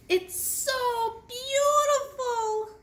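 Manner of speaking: high, slow, breathless wonder; gasping. Acted. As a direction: gasp it in awe. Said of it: "Lizzie gasped".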